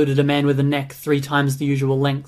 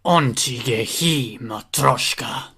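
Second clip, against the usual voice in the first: low, slow monster snarl with menace; vicious snarl; 3 semitones lower, slower than usual. A narrator's reading. Scythe-armed monster snarling at his partner. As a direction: snarl it like a cruel creature. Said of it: "snarled with a viciousness".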